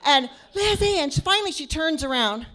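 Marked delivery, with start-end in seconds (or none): 0.5-1.0 high, loud call; yelling a name. Retold or imitated. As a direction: call her name over the music. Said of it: "I'm still yelling".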